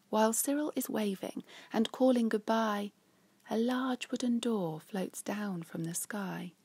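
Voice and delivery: lively read-aloud storytelling; reading a rhyming story to children; story narration. A narrator's reading. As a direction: tell it like a bedtime picture book. Picture book read aloud.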